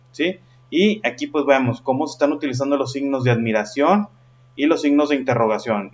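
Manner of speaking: normal talking voice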